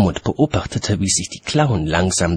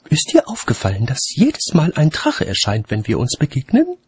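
higher, musing lady voice; lightly voiced lady; 8 semitones higher than usual. A narrator's reading. Lady pondering meetings with watchman, lightly raised voice. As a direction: muse aloud in a raised register.

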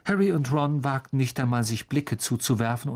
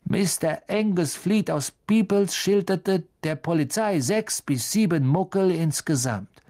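reading aloud; 6 semitones higher than usual. Acted. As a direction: read the headline mockingly. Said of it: "Er las laut vor".

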